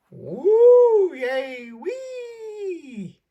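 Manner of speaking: playful woo yay wee; gleeful playground cheers. Pretend. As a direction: squeal like kids on a slide. Reader voices children playing.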